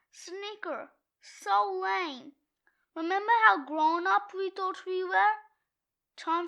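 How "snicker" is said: mock snicker. Says snicker instead of laughing.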